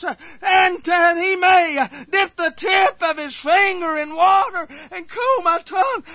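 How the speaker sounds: a sinner in hell begging God through tears; desperate crying prayer. Shown as a demonstration. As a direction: cry out begging for mercy. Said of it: "This is what he's a praying".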